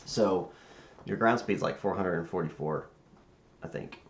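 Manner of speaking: ordinary delivery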